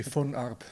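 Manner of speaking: "Von Arb" said the Swiss German way, Von as "fun", Arb with a rolled r; Swiss pronunciation. Shown as a demonstration. Guest teaching the host his Swiss name, careful Swiss German "Von Arb". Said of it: "in Swiss it would be".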